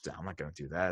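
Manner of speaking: low, soft, unimpressed brush-off; dismissive inner voice. Retold or imitated. [name] scoffing at a forum post, low and dismissive. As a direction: mutter a flat no to yourself.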